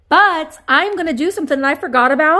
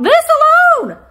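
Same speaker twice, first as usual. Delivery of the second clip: thrilled squeal; 8 dB louder than usual. Real, not acted. Thrilled at ten dollar price.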